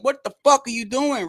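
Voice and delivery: high, loud hammed-up yell; mock angry yell. Angry father at his son, high loud mock yell. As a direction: bark it at your son. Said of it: "looking at his son like".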